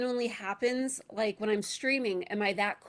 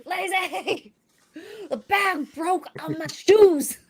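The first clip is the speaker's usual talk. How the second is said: imitating a friend's high, loud whine; hammed-up cry of dismay; mock distressed wail; 8 semitones higher, 9 dB louder, much slower than usual. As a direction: wail it slowly, half laughing.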